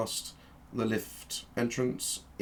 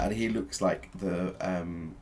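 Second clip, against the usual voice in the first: ordinary delivery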